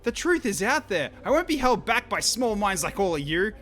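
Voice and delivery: high, loud, indignant scientist; offended quavering voice. Acted. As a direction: rant like a wounded crank. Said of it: "the scientist's offended, quavering voice".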